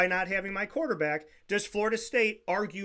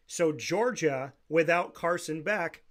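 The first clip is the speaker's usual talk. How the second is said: slow, measured, pausing between phrases; deliberately slow; slower than usual. Real, not acted. Host explaining Georgia's case for those not understanding, slowly. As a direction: lay out the example slowly. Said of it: "I will say it slow".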